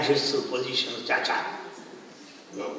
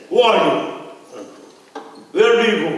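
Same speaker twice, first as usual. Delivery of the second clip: louder, higher, demanding questions; mock challenging shout; 4 semitones higher, 5 dB louder, faster than usual. Communist leader stopping the car, demanding loudly.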